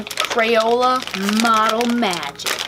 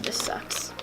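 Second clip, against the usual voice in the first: whispered; 8 dB quieter than usual. Real, not acted. Grumbling aside during makeup.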